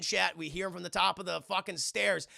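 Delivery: ordinary talk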